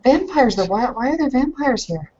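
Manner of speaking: ordinary delivery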